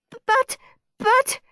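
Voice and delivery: high stammering protest; spluttered. A narrator's reading. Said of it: "spluttered Perfect Peter".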